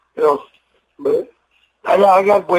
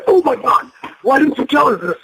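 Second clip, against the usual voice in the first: pitched well up, an amazed gasp of a question; shocked surprise; 7 semitones higher than usual. Retold or imitated. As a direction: sound like the news just floored you. Coaches learn he's a veteran.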